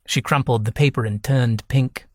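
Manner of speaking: ordinary delivery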